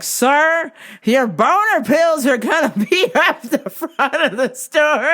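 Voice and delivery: loud, high call across a store; laughing mock yell. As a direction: yell it for a hard-of-hearing customer, laughing. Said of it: "I have to yell to tell you".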